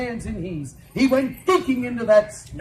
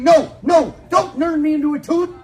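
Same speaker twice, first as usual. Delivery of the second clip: loud pleading cry, higher; begging yell; 6 semitones higher, 9 dB louder than usual. Acted. Husband begging the witch, loud pleading yell. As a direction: beg loudly not to be cursed. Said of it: "He's yelling".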